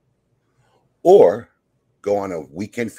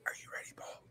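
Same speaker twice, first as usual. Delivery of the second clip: soft whisper to Paul; 22 dB quieter, faster than usual. Acted. Host whispering to Paul before the game, hushed. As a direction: whisper it like a secret.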